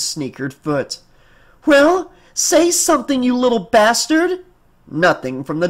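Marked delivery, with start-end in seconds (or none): 1.7-4.4 high-pitched, exasperated outburst; angry demand. Acted. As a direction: snap at the silent doll to answer. Mother loses patience with silent doll.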